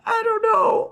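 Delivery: high-pitched helpless whine; exasperated wail. Retold or imitated. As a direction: let it rise into a helpless wail. Frustrated, cannot explain her feelings.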